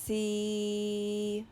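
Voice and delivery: "See" with long s and long ee; slow sounding-out. Shown as a demonstration. Teacher models a word for children, "See" drawn out slowly. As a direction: stretch "See" slowly on a level tone. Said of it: "saying the word slowly".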